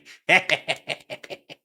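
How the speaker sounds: high, loud staccato cackle; gleeful cackle. Pretend. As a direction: cackle at the wicked plan. Gleeful about bullying someone for crying.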